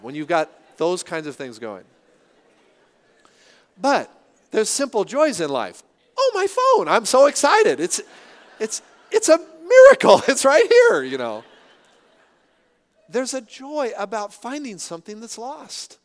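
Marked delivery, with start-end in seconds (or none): 6.2-11.0 much higher, louder, laughing mock joy; hammed-up delight at a miracle find, with a laugh; overjoyed. Mock joy at finding phone.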